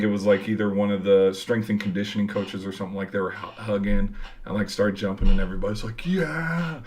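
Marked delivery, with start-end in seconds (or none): 6.1-6.8 high, held-back crowd cheer; imitated cheer. Everybody jumping in the mosh pit, imitated cheer. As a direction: mimic a crowd celebrating without full volume.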